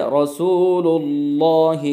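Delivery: slow रसूलुल्लाह with a full, heavy Allah; slow recitation demo. Shown as a demonstration. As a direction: draw out रसूलुल्लाह full-mouthed. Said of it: "जैसे".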